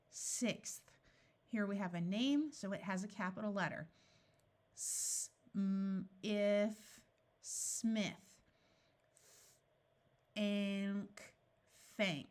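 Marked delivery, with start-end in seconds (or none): none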